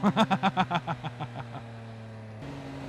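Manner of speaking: long breathy laugh; wheezy laugh. Real, not acted. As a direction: laugh it off, rising. Laugh after braking.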